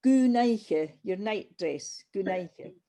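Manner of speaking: "goonaiche" said slowly with a soft DH start; "goonaiche" drawn out, the G softened; slow teacher demo. Shown as a demonstration. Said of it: "the D H is like a softer G".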